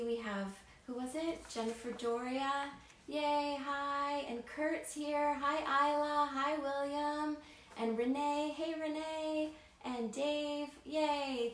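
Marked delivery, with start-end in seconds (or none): 3.1-11.5 excited greeting. Real, not acted. Greeting kids joining live.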